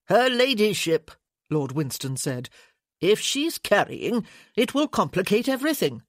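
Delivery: a lightly voiced pompous committee man; officious lord pressing his point; fussy lord. Lord Winston's voice.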